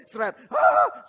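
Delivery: loud sharp cry; yelp of pain. Retold or imitated. Second needle into the toddler, sharp cry. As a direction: yelp again at the jab.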